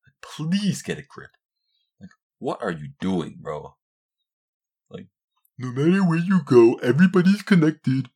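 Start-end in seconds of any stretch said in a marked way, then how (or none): 5.6-8.1 higher, louder put-on voice; hammed-up parody voice; mocking fan slogan. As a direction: parrot the slogan in mock awe. Mocking online fans' slogan.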